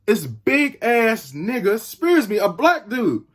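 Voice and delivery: normal talking voice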